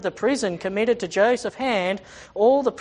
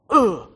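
loud, short acted oof; mock pained grunt; grunt of being kicked; 7 dB louder than usual. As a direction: grunt hard as if booted. Acting out blows Joseph takes.

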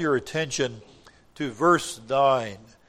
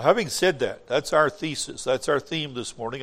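ordinary talk, nothing marked

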